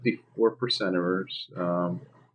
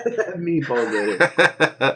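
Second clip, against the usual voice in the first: laughing; 3 dB louder, slower than usual. Real, not acted. Said through laughter.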